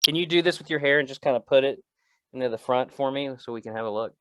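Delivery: normal talking voice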